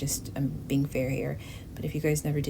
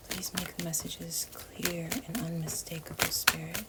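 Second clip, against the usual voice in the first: soft breathy plea; whispered prayer; 5 dB quieter than usual. Real, not acted. Reader asking Spirit for clear messages, whispered. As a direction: pray it under your breath.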